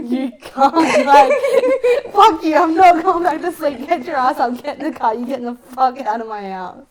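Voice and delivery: loud, high outburst broken by laughter; mock angry rant. Teller replays throwing him out that morning, mock furious. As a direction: bellow the orders while half laughing, easing off at the end.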